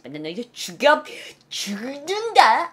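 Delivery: slow, strained threatening growl; hammed-up cursing threat; mock threat. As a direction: threaten someone, dragging the last word. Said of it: "삐 소리까지는 안 나는 욕".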